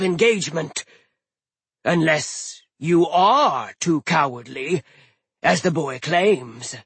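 slow booming frost giant; giant captain voice. Acted. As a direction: speak like an old ice giant. Hrym voiced.

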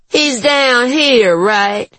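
high, slow, pushy; brash woman voice. A narrator's reading. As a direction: push past him. Juanita pushing in.

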